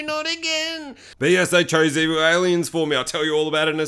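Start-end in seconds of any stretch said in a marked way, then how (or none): 0.0-1.0 mock wail. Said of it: "and I'm like".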